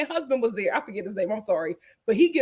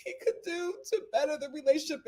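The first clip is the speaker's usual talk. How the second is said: near tears; 10 semitones higher than usual. Real, not acted. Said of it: "You're gonna make me cry".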